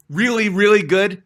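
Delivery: hammed-up brag, punching Really, really; mock-arrogant self-praise; boastful emphasis. Shown as a demonstration. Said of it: "So humble".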